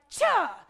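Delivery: "Cha" blurted loud with an added vowel; overdone teacher sound. Retold or imitated. Teacher showing colleagues their over-hard sounding, loud mock "Cha". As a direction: punch out "Cha" with an extra uh on the end. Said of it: "doing the teacher thing where you're like".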